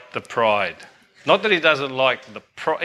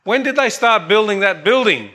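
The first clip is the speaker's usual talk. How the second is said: raised-pitch visitor's pointed question; higher-pitched voice for a joke's American; brash American visitor; 6 semitones higher than usual. Acted. American tourist asking his Italian host, brash. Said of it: "he says to him".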